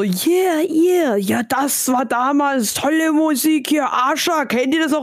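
höher und langsamer, verklärter Opa; alter Mann schwärmt. Pretend. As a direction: wie ein alter Mann von früher. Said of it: "Ja, dann hörst du so". (höhere Opa-Stimme, die von alter Musik schwärmt)

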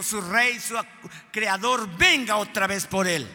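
loud impassioned sermon reading; fervent preaching. Acted. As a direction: lift the words with longing.

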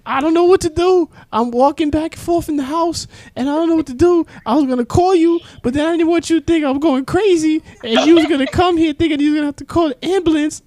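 very high, loud panicked mom voice; hammed-up mother reliving her paranoia; a mom telling her son how high she got, in a shrill mock voice; imitating mom. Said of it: "She's like".

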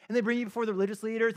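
normal talking voice

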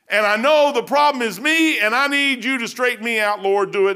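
loud, driving preacher's cry; preaching at full force. Acted. Pastor urging his congregation, loud fervent preaching.

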